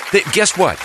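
usual speaking voice

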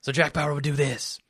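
short whispered agent voice; Jack Bauer voice. Shown as a demonstration. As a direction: clip it off in a terse agent whisper.

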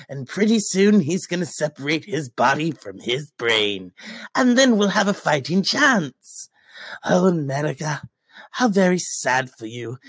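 lightly voiced Joker, smug; a hint of the mocking Joker. Joker mocking America's politics, lightly voiced.

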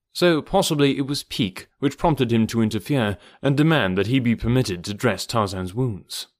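plain reading voice

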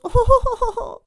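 high, loud imitation of a customer's drawn-out "oh"; customer's shocked cry. Customer to teller counting his money, mock shocked cry. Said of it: "he had a really thick accent".

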